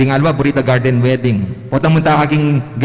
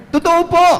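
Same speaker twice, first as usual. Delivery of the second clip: put-on kid insisting. Reynold insisting to the teacher, very high. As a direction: insist like an earnest boy.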